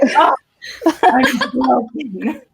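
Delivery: slow, high, theatrical moan of despair; mock heartbroken. Reader hit by a Jamie line, in mock despair. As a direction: ham up being emotionally wrecked.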